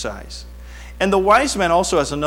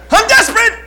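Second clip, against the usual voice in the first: loud cry leaping very high; hammed-up desperation, shouted; worked-up outburst; 9 dB louder than usual. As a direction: cry out as if at the end of your rope. Relives being worked up.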